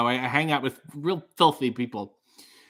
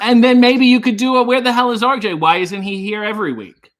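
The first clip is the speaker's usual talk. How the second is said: loud; 5 semitones higher, 9 dB louder than usual. Pretend. Suggesting fans' chant.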